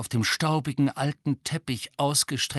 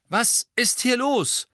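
alarmed call; 12 semitones higher, 5 dB louder, slower than usual. A narrator's reading. Lupin storms in.

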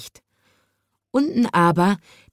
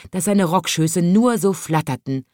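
plain reading voice

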